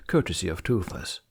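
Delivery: ordinary delivery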